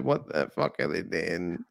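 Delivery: muttered to yourself in shock; stunned disbelief. Retold or imitated. Recalling the eyeballs scene, slow quiet appalled disbelief. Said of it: "I was like".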